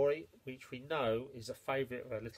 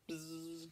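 soft buzzing hum; fly buzz; 3 semitones higher, 11 dB quieter than usual. Acted. The flies buzzing at the mole, a soft hum.

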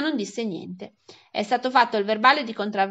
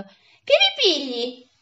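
high, drawn-out startled exclamation; acted sleeper's startled outburst; startled awake; 6 semitones higher, 4 dB louder, much slower than usual. A narrator's reading. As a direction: cry out as if jolted from sleep. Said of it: "si svegliò di soprassalto, esclamando".

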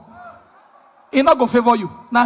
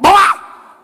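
sudden loud, higher bang noise; imitated knock; 7 semitones higher, 5 dB louder than usual. Storyteller imitating the knock the smoker heard, loud bang. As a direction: make the knock with a shouted burst.

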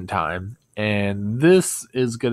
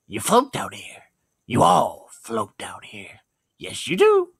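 drawn-out menace ending in a clown cackle; Pennywise voice, retake; slower than usual. Pretend. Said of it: "get the clown laugh right".

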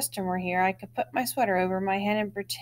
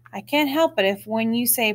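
plain reading voice

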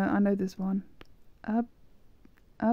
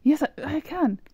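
higher, louder burst of delight; excited; 6 semitones higher, 4 dB louder than usual. Real, not acted. Gamer thrilled she can change her colour, excited. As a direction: celebrate the small win.

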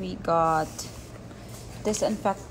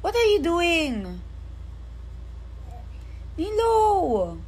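calling out; 4 semitones higher, slower than usual. Real, not acted. Parent catches child making mess.

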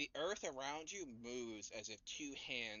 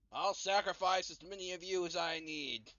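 high, raised voice of a haughty tyrant; imperious, haughty yelling; 5 semitones higher than usual. Acted. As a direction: call it out like an imperious commander. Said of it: "imperious, uh, haughty, like, person yelling".

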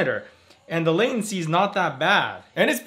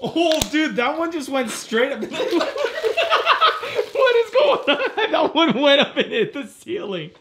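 very high, squealed through laughter; real hysterical laughing; 11 semitones higher, slower than usual. Real, not acted. Friend reacting as it shoots to the ceiling, hysterical laughing. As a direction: crack up helplessly while exclaiming.

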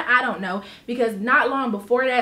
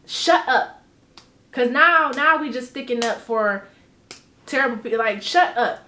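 ordinary talk, nothing marked

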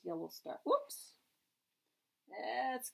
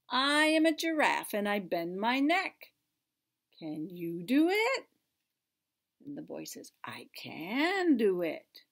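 loud, lively picture-book read-aloud; reading to children; 8 dB louder than usual. Acted. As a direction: read it big and bright for little kids.

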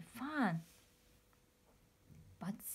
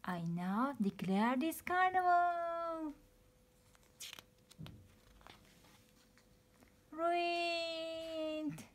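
high, gloating villain declaration; screeching villain; 7 semitones higher, much slower than usual. Acted. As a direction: crow over your triumph. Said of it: "screeched the pea".

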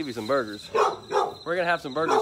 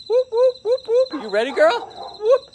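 high, loud whooping call to the dog; calling out whoops; 6 dB louder than usual. Real, not acted.

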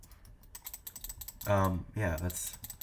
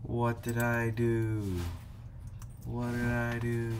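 mock innocence; 4 semitones higher, slower than usual. Feigning innocence to rager.

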